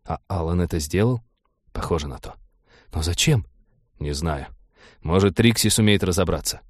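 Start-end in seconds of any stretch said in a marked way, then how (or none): none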